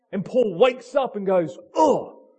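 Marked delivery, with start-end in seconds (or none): 1.7-2.3 loud, high "Oh"; sudden realisation. Acted. Paul waking up to the truth, loud surprised oh. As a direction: burst out as it dawns.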